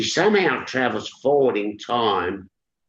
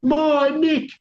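high, loud anguished howl; 4 dB louder than usual. Acted. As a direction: howl for your child.

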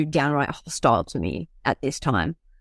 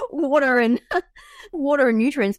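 laughing through words; 9 semitones higher than usual. Real, not acted.

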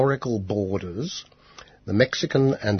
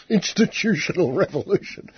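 laughing; 10 semitones higher than usual. Shown as a demonstration. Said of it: "it's almost an oxymoron".

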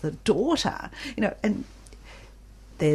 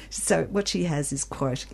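ordinary talk

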